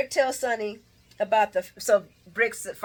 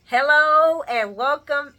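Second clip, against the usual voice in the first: drawn-out, louder grand welcome; mock announcer welcome; 4 semitones higher, 6 dB louder, slower than usual. Vlogger hailing Anna as the new police commissioner, mock announcement. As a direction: announce it slowly, higher and louder.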